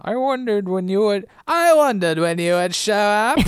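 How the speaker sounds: high, laughing, hammed-up British voice; hammed-up British Widmore. Asked to do Widmore, a hammed-up high British voice. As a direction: overdo the British villain, cracking up. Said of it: "do Widmore for me".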